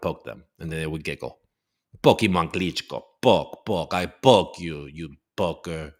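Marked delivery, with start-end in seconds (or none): 2.0-5.9 playful mock Russian accent, lower; dad poking his kids in a silly Russian accent. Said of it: "a Russian accent with my kids".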